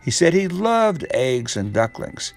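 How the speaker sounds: ordinary delivery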